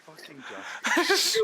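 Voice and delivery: high, loud exasperated cry of a name; exasperated yell. Real, not acted. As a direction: yell the name in mock outrage. Exasperated outburst at friend.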